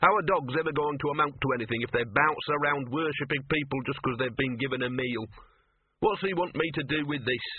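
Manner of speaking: indignant streetwise mutt; grumbling dog. Acted. Dog scorning Laddie's devotion, grumbling. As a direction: grumble as a put-upon dog.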